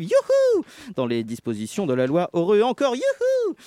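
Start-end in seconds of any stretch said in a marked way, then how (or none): 0.0-0.6 mock woo-hoo, squealed up high; a fake delighted whoop; sarcastic cheer. Sarcastic cheer mid-chronicle. 2.3-3.5 mock woo-hoo, squealed up high; a fake delighted whoop; sarcastic cheer. Sarcastic cheer mid-chronicle.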